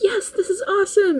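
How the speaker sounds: high, bright-eyed mock enthusiasm; imitating an eager yes; excited. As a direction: gush about it like a kid. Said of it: "And Chris will be like".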